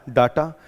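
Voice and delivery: डाटा with open a, "daa-ta"; Indian pronunciation. Shown as a demonstration. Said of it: "हमारे यहाँ क्या बन गया". (हमारे यहाँ का उच्चारण डाटा)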